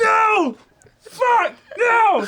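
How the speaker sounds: hammed-up despairing shriek; mock anguished scream. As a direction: scream it like the world is ending. Acting out a character's outburst.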